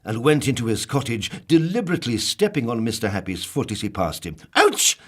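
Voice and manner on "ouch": very high, loud yelp; loud high pained ouch. Acted. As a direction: yelp as your foot is trodden on. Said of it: "said Mister Happy".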